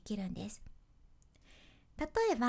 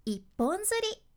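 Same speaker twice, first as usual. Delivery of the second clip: 一本釣り lifted high and louder; high pitch peak; 8 semitones higher, 3 dB louder, slower than usual. Shown as a demonstration. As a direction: make 一本釣り jump up in pitch. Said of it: "しっかり音の高低差をつける".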